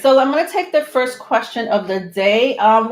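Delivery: ordinary talk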